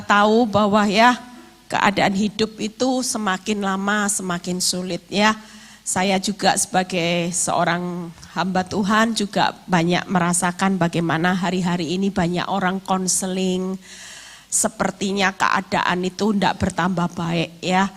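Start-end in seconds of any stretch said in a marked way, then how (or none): none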